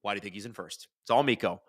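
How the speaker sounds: normal talking voice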